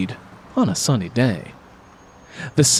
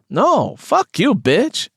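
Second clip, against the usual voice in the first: higher, slow, biting comeback; angry snapped insult; 10 semitones higher, much slower than usual. Acted. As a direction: throw the insult right back, pitched up. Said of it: "Carmen snapped at her".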